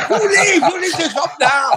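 very high mock Chinese-accented wife; shrieking shopkeeper's wife, Chinese accent put on. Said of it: "And the wife said".

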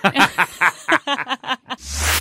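high-pitched burst of laughter; real laughter. Real, not acted. Co-host reacting to the surgery joke, laughing hard.

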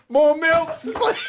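laughing plea. Real, not acted. Chili burning, begging for milk.

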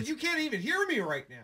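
high, off mic; far from mic. Real, not acted. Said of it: "It sounds like you're far away".

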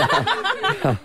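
a bright, high laugh breaking out; real burst of laughter. Real, not acted. Host laughing at co-host's lack of Star Wars knowledge, real laugh. Laughs at colleague's cake ignorance.

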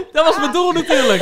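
real laughter through the words; laughing. Real, not acted. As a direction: laugh while admitting it. Laughing about Tinder dates.

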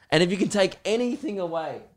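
ordinary talk, nothing marked

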